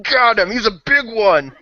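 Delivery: high, loud battle holler; acted shout. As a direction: shout it across a chaotic fight. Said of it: "you hear me shout".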